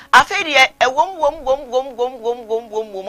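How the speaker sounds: repeated "wom wom" starting high and loud, then fading; mock sound effect. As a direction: chant the "wom" sounds with energy that winds down. Imitating a sound while telling a story.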